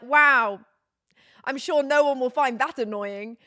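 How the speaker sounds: sarcastic. Retold or imitated. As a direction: heap sarcasm on the retort. Sarcastic retort in retold call.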